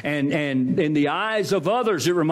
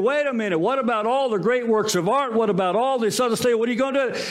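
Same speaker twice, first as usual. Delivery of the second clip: higher-pitched mock outcry from the crowd; indignant protest; 6 semitones higher, faster than usual. Crowd to auctioneer about the unsold art, indignant protest. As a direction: protest like a mildly outraged bidder. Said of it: "everybody was throwing a fit".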